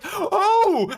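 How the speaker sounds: high, loud shocked gasp; charity woman startled at the door. Retold or imitated. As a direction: jolt back in shock.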